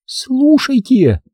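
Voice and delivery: high, drawn-out female command; woman's slow urgent call, not whispered. Acted. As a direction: slowly urge in a high voice. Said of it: "прошептала она".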